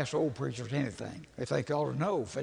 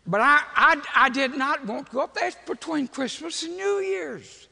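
high, loud, hammed-up complaining; griping protest; 8 semitones higher, 4 dB louder than usual. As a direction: protest the trip at full volume, drawing out the last words. Complaining to pastor about going.

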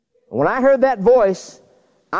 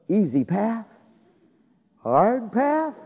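quiet thought; 6 dB quieter, slower than usual. Retold or imitated. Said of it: "I thought to myself".

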